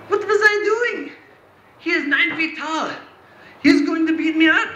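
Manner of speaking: high-pitched dawning dread; acted sudden panic. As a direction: fret aloud in a high comic panic. David's sudden panicked thoughts.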